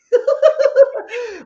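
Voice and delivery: high, loud burst of laughter; delighted squealing laugh; shrieking laugh. Real, not acted. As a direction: let out a big shrieking laugh. Big laugh.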